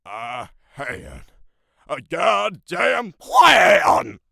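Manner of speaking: drawn-out outlaw shout, easing off at the end; Dutch van der Linde. Acted. Outlaw leader berating Arthur over his plan, slow bellowing impression. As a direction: bellow slowly, then drop back on the name. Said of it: "Dutch van der Linde is also a, a voice I'm pretty good at".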